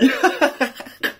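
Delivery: high burst of laughter; a real giddy laugh; delighted laugh. Real, not acted. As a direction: laugh at the lucky pull. Laughing at the back-to-back pull.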